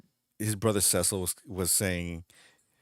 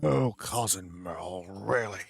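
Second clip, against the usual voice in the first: slow, weary, exasperated drawl; annoyed Sideshow Bob; slower than usual. Retold or imitated. Bob dismissing his cousin's news, slow exasperated drawl. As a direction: sigh it out, deeply unimpressed. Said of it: "my favorite fucking Sideshow Bob line".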